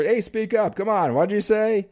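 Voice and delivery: mock impatient demand; pushy speak up. Shown as a demonstration. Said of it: "you don't ever".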